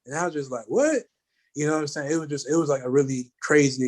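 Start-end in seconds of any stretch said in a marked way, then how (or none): 0.7-1.0 stunned disbelief. Retold or imitated. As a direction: blurt out the shock of being recognised. Retelling his disbelief at being praised.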